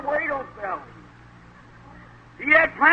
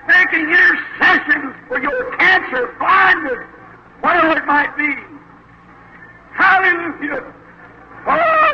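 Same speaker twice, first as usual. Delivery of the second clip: rising, louder preacher's cry; full-force preaching; 3 semitones higher, 4 dB louder than usual. Acted. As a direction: build the fervour to a shout.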